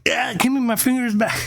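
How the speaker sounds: high-pitched, held-back mock yell; mock panic. Acts out panicked plea to biter.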